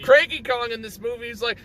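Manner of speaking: ordinary talk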